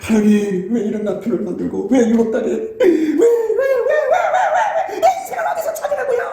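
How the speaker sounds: rising to a shrill, laughing whine of complaint; mock whining wail. Complaining to God, voiced wail. (하나님께 따지듯 울먹이며 점점 높아지는 과장된 하소연)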